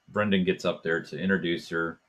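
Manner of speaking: ordinary talk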